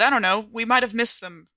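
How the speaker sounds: usual speaking voice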